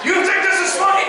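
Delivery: high, loud instructor challenge; imitated yelling. Drill instructor to a giggling cadet, loud mock fury. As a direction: yell it as an accusation. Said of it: "he starts yelling at me".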